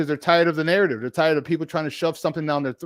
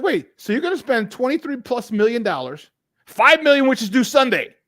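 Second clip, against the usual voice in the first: incredulous objection; 6 semitones higher than usual. Retold or imitated. Host arguing back at giddy radio guys, incredulous. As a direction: protest it in a raised voice.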